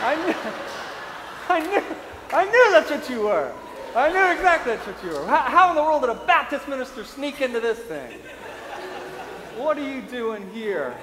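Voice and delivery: mock triumphant disbelief; gleeful laughing gloat. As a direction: priest mocking the Baptist minister, laughing.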